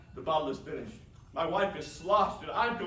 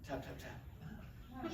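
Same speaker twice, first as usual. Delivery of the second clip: soft tapping noise; 8 semitones lower, 17 dB quieter, much slower than usual. A narrator's reading. Narrated knock sound.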